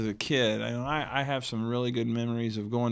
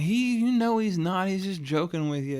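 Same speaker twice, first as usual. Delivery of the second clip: lightly voiced mom; 7 semitones higher than usual. Mom voiced reassuring child.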